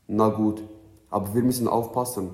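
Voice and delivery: ordinary delivery, nothing marked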